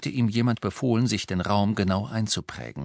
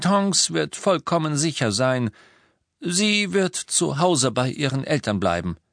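higher-pitched, strange detached assurance; almost cold; 7 semitones higher than usual. A narrator's reading. Husband brushing off worry about his wife, almost coldly. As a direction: insist on it with a touch of coldness. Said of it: "Er klang beinahe kalt".

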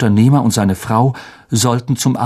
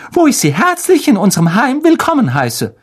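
high-pitched gushing hostess aunt; rehearsed eager welcome; 12 semitones higher, 3 dB louder than usual. A narrator's reading. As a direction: recite the welcome promptly in a high put-on voice. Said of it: "wie aus der Pistole geschossen".

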